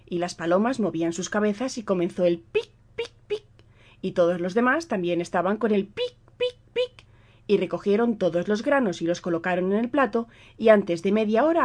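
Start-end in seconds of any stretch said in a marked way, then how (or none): 2.9-3.4 pecking sound. A narrator's reading. 6.4-7.1 pecking sound. A narrator's reading.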